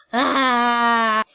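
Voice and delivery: jolted short scream. Real, not acted. Startled scream in game.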